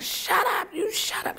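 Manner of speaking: high mocking snap imitating him; angry driver. Driver silencing her in the car, high mock yell. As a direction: bark the order back as he did, high. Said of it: "And he was like".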